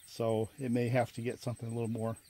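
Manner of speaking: normal talking voice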